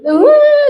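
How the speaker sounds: high, loud fake bawl; mock crying wail. Teased child bursting into tears, mock wailing.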